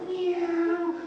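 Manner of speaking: very high mewing meow; a high drawn cat cry. Retold or imitated. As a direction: mew like a cat. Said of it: "the cat to go".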